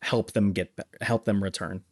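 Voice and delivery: ordinary talk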